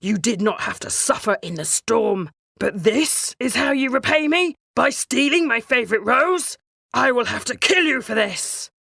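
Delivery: booming, threatening beast voice; a beast furious over his stolen rose; loud angry beast threatening death. Acted. Beast confronts the merchant.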